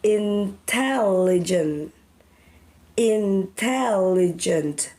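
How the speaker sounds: each syllable said slowly and separately; slow syllable drill. Shown as a demonstration. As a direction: sound out in-tel-li-gent slowly for the class. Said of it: "The word intelligent has four syllables".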